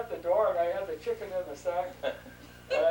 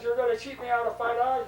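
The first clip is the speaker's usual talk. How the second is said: loud, raised-pitch retold outrage; indignant protest; 6 semitones higher, 7 dB louder than usual. Man being cheated out of five dollars, indignant protest. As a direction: play the swindled buyer, higher and louder.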